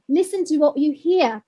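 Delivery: ordinary delivery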